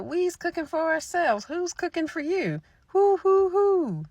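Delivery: high, loud cackling witch taunt; mocking old witch. Old witch mocking the hungry visitor, high and loud. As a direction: taunt the stranger like a gleeful old crone. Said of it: "the old woman started laughing and said".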